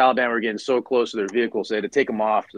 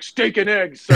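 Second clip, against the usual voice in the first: eager; 9 semitones higher, 5 dB louder than usual. Retold or imitated. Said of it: "He's like".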